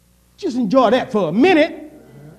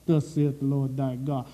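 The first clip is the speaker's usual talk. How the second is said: "Thus saith the Lord thy God" said lower and softer; low hushed reading; 10 semitones lower, 7 dB quieter than usual. Shown as a demonstration. Preacher repeating the verse to the church, low and hushed. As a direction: drop it down, hushed and weighty. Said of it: "Still talking about".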